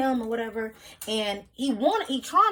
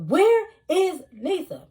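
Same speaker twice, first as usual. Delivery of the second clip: high, loud frantic shout for her sister; much higher, louder panicked call; 10 semitones higher, 9 dB louder, slower than usual. Retold or imitated. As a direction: yell it out, desperate to find her. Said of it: "So I'm like".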